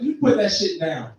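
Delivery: ordinary talk, nothing marked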